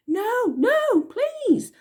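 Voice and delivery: high pleading cry; begging. A narrator's reading. Elsie begging Bella to stop, high and pleading. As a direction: beg for mercy.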